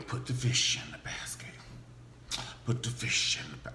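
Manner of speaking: soft unvoiced whisper; mock whispered harvest ritual. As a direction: hush it like a secret. Acting hushed ritual.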